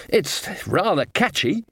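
high, slow mock scream; restrained scream. A narrator's reading. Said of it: "screamed the dean".

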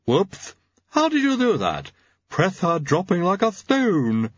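high, excited lisping servant; astonished lab assistant, high and drawn out; Igor. Acted. Igor exclaims at pressure drop.

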